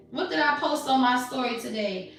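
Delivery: ordinary talk, nothing marked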